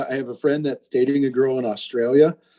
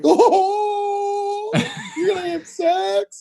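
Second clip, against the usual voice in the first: put-on very high, drawn-out girlish whoop; mock squealing girls; much slower than usual. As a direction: imitate excited girls in a shrieky high voice. Imitating squealing bachelorette party girls.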